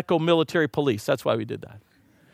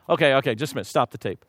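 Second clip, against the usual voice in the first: ordinary delivery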